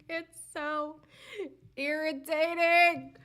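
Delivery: loud, slow, exasperated mock whine; drawn-out loud whine.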